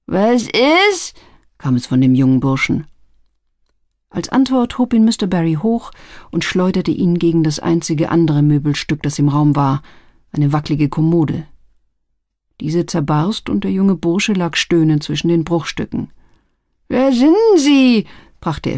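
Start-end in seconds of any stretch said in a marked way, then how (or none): 0.1-1.1 higher-pitched, questioning voice; lightly voiced lad jolted awake; startled young man. A narrator's reading. Young man roughly woken. 16.9-18.1 high, slow, louder question; dazed lad demanding answers; hurt, alarmed young man. A narrator's reading. Young man thrown, groaning, asks.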